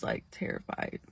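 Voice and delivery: whispered terrified. Real, not acted. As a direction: breathe the word out in dread. Recalling terror, hushed.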